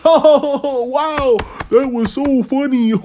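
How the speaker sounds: mocking high-pitched gushing; mock shrill fake laugh reaction. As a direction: parody an overexcited fan squealing. Mocking feminists laughing at joke.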